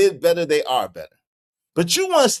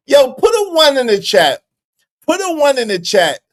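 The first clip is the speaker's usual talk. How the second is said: loud, raised rallying call; 7 semitones higher, 6 dB louder than usual. Real, not acted. Streamer tells viewers to respond in chat, loud call-out. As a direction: holler it out to the whole audience.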